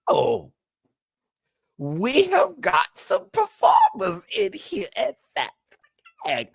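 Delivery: high, slow, singsong sass; sassy drag voice. Retold or imitated. Vlogger praising the lip syncers, high slow campy sass. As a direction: drawl it like a campy drag queen. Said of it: "I was like".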